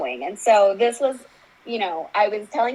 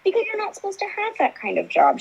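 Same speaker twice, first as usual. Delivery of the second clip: high-pitched quoted therapist; therapist's reassurance; 11 semitones higher than usual. Retold or imitated. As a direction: voice a therapist setting a client straight. Voicing therapist's emphatic answer.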